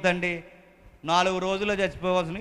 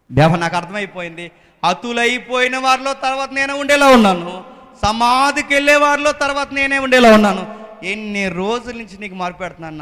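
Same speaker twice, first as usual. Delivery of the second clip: lamenting psalmist; 4 semitones higher, 7 dB louder than usual. Acted. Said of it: "ఆ బాధల్లో రాస్తున్నాడు పాట".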